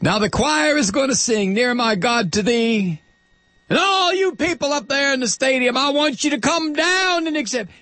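imitating an evangelist inviting the crowd to come forward; Billy Graham altar call. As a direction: call the stadium crowd down like a revival preacher. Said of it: "he'd issue that call to come down".

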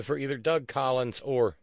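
ordinary delivery

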